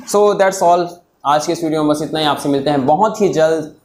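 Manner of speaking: normal talking voice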